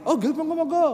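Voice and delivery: high, loud eager Javanese becak driver. Retold or imitated. Pedicab driver greeting a late-night customer, high and eager. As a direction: play the keen pedicab man, pitched high.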